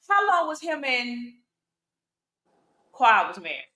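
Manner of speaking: ordinary talk, nothing marked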